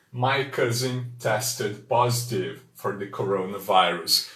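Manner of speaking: My cousin tested positive for the coronavirus, read slowly; slow model sentence. Shown as a demonstration. Teacher repeating the example for learners, slowly. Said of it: "Mais uma vez".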